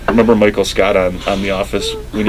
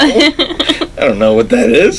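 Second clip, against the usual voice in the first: very high, slow, drawn-out protest; mimicked bafflement; much slower than usual. Imitating Daniel's bafflement, high slow whine. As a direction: stretch it out in a high voice. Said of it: "It was the way you said it".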